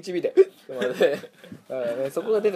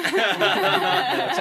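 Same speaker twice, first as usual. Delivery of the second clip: short laugh; 6 semitones higher, 7 dB louder than usual. Real, not acted. Laughing at the solved riddle.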